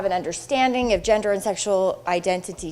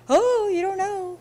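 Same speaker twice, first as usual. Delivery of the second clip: mock gotcha; 9 semitones higher than usual. Mocking a know-it-all.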